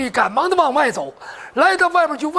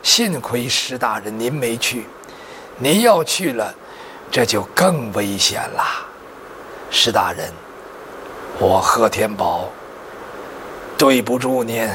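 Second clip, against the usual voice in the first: dying man's words; 13 semitones lower than usual. Acted. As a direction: force out a last apology, low and labored. Dying He Tianbao apologises to his lord. (临终遗言，低沉缓慢)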